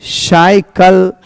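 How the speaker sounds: letter by letter, very slow; spelling aloud slowly. Shown as a demonstration. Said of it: "हम लोग देख रहे हैं ना".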